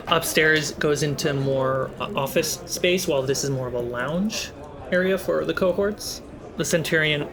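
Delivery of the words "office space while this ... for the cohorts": ordinary talk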